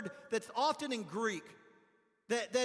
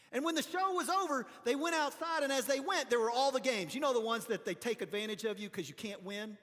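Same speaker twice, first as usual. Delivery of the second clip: ordinary talk, nothing marked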